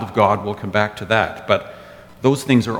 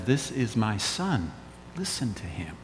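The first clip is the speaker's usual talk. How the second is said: hushed divine voice; 11 dB quieter than usual. Retold or imitated. Voice from the cloud speaks.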